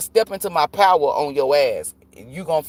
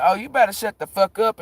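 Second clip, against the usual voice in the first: high, sharp threat; threatening; 7 semitones higher than usual. Retold or imitated. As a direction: warn him off, hammed-up. Threatening the owner in story.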